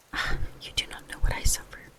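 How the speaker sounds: plain reading voice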